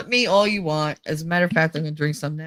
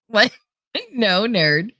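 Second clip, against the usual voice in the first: usual speaking voice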